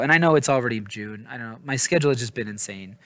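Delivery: usual speaking voice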